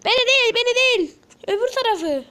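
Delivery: high, loud shout at the screen; frustrated yelling. Real, not acted. Streamer yelling at the game about the wrong target, loud and high. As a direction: yell in panic that it's the wrong target.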